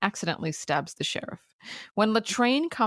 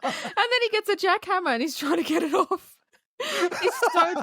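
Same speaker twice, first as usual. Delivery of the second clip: laughing; 9 semitones higher than usual. Real, not acted. Giggling retelling the jackhammer scene.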